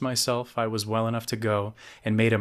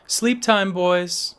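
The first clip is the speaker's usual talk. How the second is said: loud, high call from the hall; bellowing mom; 10 semitones higher, 6 dB louder, much slower than usual. A narrator's reading. Mom to the boys from the hall, bellowed. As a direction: call out to the kids, pitched way up. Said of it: "Mom bellowed from the hall".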